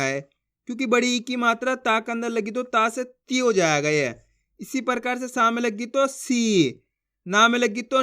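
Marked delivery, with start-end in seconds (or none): 6.1-6.8 सी with a long held ee; drawn-out vowel. Shown as a demonstration. Teacher models सा with बड़ी ई, सी drawn out long. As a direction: stretch the ई in सी. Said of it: "जिस तरीके से मैं खींच खींच के पढ़ रहा हूँ".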